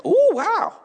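very high, hammed-up wow; mock surprise. Stranger is told about the job, very high mock amazement. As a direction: play the impressed stranger. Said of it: "stranger reacts".

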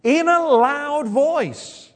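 LOUD drawn out, high and strong; emphasized. Shown as a demonstration. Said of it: "look at the, how it's emphasized".

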